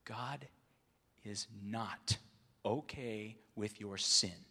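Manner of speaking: ordinary talk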